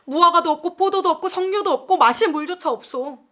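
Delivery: piling up grievances in an acted protest; accusing complaint. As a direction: confront the leader as an aggrieved crowd. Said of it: "몰려와서 다투며 따지는 거예요".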